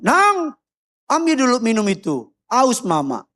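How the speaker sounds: high call easing into a request; mother calling. Retold or imitated. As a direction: voice a mother asking a favour from afar. Acted mother calling her child. (ibu memanggil dan menyuruh anaknya)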